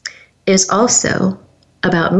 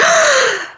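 sharp gasp of realization; re-enacted sudden intake of breath. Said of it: "I gasped, I said".

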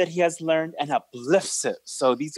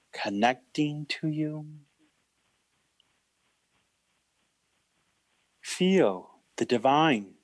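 guided meditation. Acted. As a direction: invite the divine gently.

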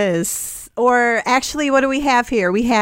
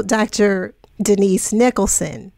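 ordinary delivery

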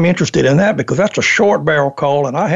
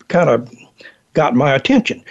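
normal talking voice

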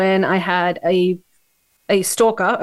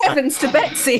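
put-on pitched-up old-fashioned exclamation; mock prim gasp; 5 semitones higher than usual. Teasing about Botox, mock prim shock. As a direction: clutch pearls in a put-on voice.

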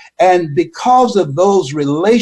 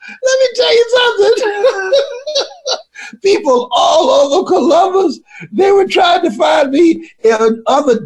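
laughing while talking. Real, not acted. Speaker telling the crowd through laughter, shouting high. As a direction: push the words out through laughter.

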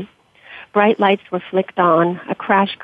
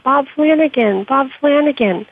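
calling out; 6 semitones higher than usual. Acted. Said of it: "Then she called out".

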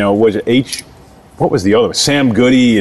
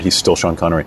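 ordinary talk, nothing marked

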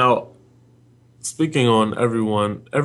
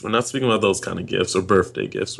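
ordinary talk, nothing marked

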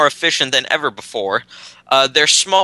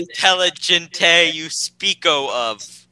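fake Italian accent; 3 semitones higher, slower than usual. Mock foreign accent.

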